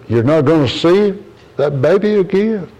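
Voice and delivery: asking in shocked disbelief; surprised question. Retold or imitated.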